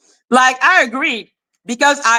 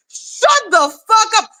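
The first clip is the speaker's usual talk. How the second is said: angry yell; 9 semitones higher than usual. Real, not acted. Yelling at rival.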